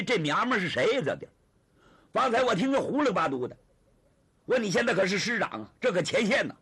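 loud, indignant dressing-down; forceful, exasperated remonstrance; acted scolding. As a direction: berate a comrade at full storyteller volume. Tang scolds his commander for embracing.

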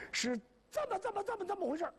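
high stammering hesitation; stall, too scared to say it; nervous stammer. Acted. Shoemaker stammers about the lost case, high and nervous.

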